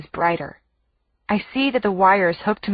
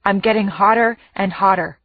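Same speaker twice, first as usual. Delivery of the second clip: plain reading voice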